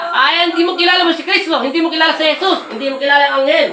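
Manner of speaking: high, loud, relentless commands; loud exorcist rebuke. Acted. Healer ordering the spirit to leave the patient, loud and forceful. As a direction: drive the spirit out with every line.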